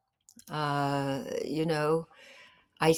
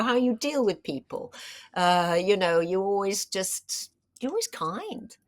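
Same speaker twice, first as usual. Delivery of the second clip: usual speaking voice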